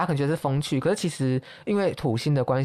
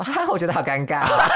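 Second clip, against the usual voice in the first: awkward laughing confession; nervous laugh; 3 semitones higher, 4 dB louder than usual. Retold or imitated. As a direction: laugh awkwardly while admitting it. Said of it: "直接跟他讲说". (尴尬地笑着说)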